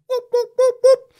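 high-pitched mock barking whoops; imitated dog barks. Preacher imitating the dogs of doom, high mock barking. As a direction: yap like a little guard dog. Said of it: "dogs there barking".

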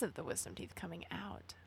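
conspiratorial stage whisper. Acted. Host jokes to listeners about wisdom teeth, whispering. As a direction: whisper it like a secret aside.

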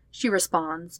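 ordinary delivery

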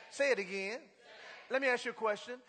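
ordinary delivery

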